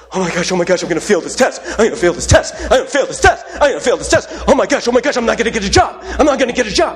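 spiral into a put-on frenzy of worry; mock panic. Preacher acts out worrying about test and job, mock panic. Acts out anxious self-talk.

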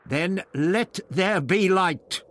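high, slow, declaimed challenge; bold proclamation. A narrator's reading. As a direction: proclaim it grandly and slowly. Ian's dramatic challenge to priest.

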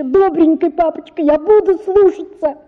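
high, weeping plea; a child chasing father, crying; высокий плачущий крик. Acted. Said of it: "кричала я".

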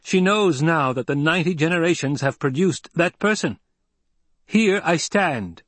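high, forceful public proclamation, slowing at the end. A narrator's reading. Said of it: "Paul raised his voice".

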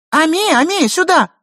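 high, loud call to a pet dog; lady's shout. A narrator's reading. Mistress calling her little dog, high shout. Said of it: "закричала хозяйка".

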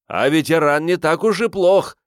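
high, loud shout over the crowd. A narrator's reading. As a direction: yell it into his ear. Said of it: "прокричал в самое ухо Риордану меркиец".

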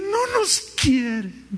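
high, slow mocking complaint; mock whine. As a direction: mimic the sulky members. Mocking the departed members.

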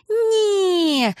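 put-on high little-boy voice, word stretched out; cheerful drawn-out denial. A narrator's reading. Boy tossed in the air answers his father, cheerfully. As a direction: draw it out gleefully, high like a child. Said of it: "протянул Алекс весело".

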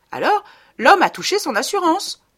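high, loud, sparkling wife; fairy voice. Acted. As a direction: chirp it like a clever fairy. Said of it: "d'une voix de fée".

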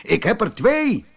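high-pitched answering call. A narrator's reading. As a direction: call it back across the distance. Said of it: "riep Ben".

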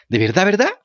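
high, bouncing with joy; joyful cry. A narrator's reading. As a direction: shout it out in delight. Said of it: "gritó la marioneta saltando de alegría".

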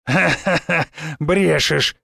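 acted ha-ha-ha, then disbelief; scoffing chuckle. A narrator's reading. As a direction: laugh it off as a lie. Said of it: "тем временем усмехнулся он". (недоверчивая усмешка)

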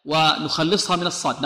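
ordinary delivery

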